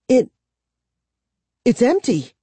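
high, stammered in fright; stammering. A narrator's reading. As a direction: stumble over the first word. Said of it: "Kara stammered".

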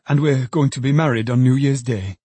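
plain reading voice

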